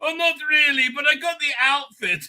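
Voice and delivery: high, hammed-up parody of the protester; mock him in a high, gushing voice; mocking impression. Mocking the pro-Palestine marcher clip.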